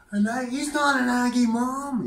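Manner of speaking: very high, loud, shrieking mother's cry; a mother's horrified high shriek at her son's shirt; mock shrill outcry. Retold mother's shocked outburst.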